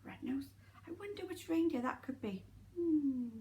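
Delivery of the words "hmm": ordinary delivery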